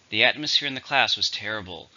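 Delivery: normal talking voice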